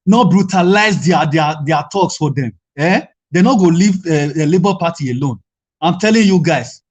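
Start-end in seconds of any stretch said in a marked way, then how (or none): none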